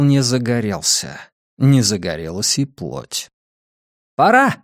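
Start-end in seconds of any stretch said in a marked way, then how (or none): 4.2-4.6 high loud shout over roaring flames; shouting over machinery. Acted. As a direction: yell it over the roar of fire. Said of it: "прокричала женщина сквозь рёв пламени".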